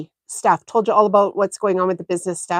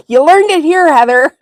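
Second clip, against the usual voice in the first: teasing exclaim; 7 semitones higher, 7 dB louder than usual. Real, not acted. Teasing a viewer named Heather.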